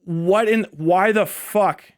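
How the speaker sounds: ordinary talk, nothing marked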